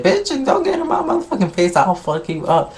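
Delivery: high-pitched mock-angry threat; heated threat. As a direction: imitate someone squaring up in an argument, pitch shooting up. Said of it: "the argument starts getting heated".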